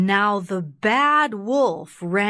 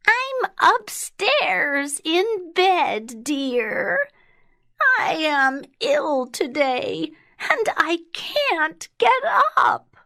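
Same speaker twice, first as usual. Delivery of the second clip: put-on very high, quavery old lady; high-pitched, plaintive sick grandma; ill grandma calling; 10 semitones higher than usual. A narrator's reading. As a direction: call down feebly in a high granny voice. Ill grandma calls from bed.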